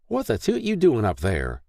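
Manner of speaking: scolding. A narrator's reading. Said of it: "Mary scolded".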